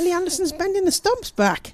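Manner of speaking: high delighted exclamation; excited. Real, not acted. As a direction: marvel at the bowling. Excited about bowling.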